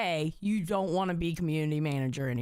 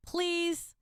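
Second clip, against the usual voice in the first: pleading; 7 semitones higher, slower than usual. Real, not acted. Begging Albert to wear shirt.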